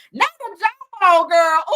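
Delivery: hammed-up scandalized cry; clutching pearls; mock shock.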